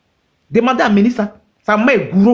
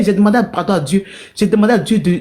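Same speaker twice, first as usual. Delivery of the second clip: normal talking voice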